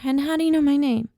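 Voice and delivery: put-on suspicious child firing off a question; small, distrusting voice. A narrator's reading. Hidden boy questioning a stranger, small distrusting voice. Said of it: "a small, distrusting voice".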